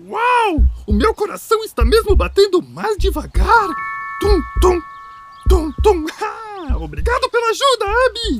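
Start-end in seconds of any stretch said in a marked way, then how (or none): 4.2-6.1 heartbeat sounds. Pretend. Imitating a slowed heartbeat.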